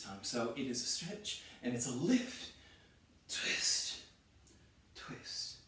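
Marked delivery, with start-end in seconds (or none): 3.3-4.0 whispered twist; whispered cue. Real, not acted. Cueing the twist. 4.9-5.6 much softer whisper; whispered cue. Real, not acted. Cueing the twist.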